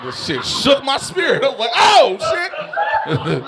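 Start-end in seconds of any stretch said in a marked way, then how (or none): 1.7-3.4 mock shock. Shocked reaction.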